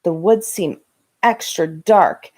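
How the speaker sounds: ordinary delivery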